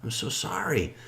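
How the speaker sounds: high soft consoling voice; gently sympathizing old man; sympathetic. Retold or imitated. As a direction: comfort him softly. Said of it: "old man comforts drunk".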